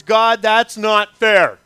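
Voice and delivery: slow, loud cry of complaint at God. Acted. Pastor voices the congregation's complaint to God, loud and slow. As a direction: protest it slowly and loudly, as if wronged.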